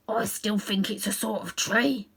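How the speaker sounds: slow whispered warthog voice; slow whispering warthog; stubborn warthog. Acted. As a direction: grunt it out under the breath, unconvinced. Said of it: "said the warthog".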